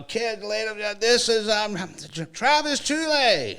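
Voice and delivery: imitating a school announcer; mock announcer intro. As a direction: build up the big introduction. Announcer introducing Travis to kids.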